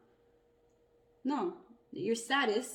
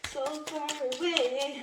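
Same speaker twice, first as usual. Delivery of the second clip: higher, slow, drawn-out eerie voice; eerie echo; 7 semitones higher, 3 dB louder, slower than usual. Retold or imitated. Echoing a voice she keeps hearing, slow and high. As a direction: echo a far-off spirit voice. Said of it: "I keep hearing that".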